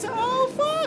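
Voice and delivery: loud high shocked curse; surprised curse. Real, not acted. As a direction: blurt it out in shock. Game twist reaction.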